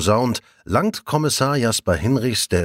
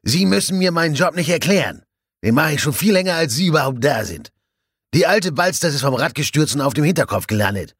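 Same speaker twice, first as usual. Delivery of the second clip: higher, indignant professional pride; irritated doctor; 6 semitones higher than usual. A narrator's reading. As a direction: put the upstart colleague in her place. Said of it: "Der Arzt kommt jetzt auch in Fahrt". (gereizt und von oben herab)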